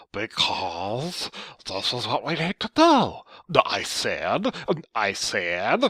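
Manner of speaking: slow, drawn-out mocking voice; annoying slow voice. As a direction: drag every word out obnoxiously. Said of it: "talking in this really annoying, slow voice".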